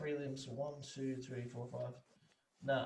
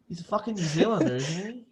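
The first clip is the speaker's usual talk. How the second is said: loud, higher, disbelieving; loud incredulous; 4 semitones higher, 9 dB louder than usual. Retold or imitated. Reacting to a podcast mistake, loud disbelief. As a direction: shout it in disbelief at the radio. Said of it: "And I'm like".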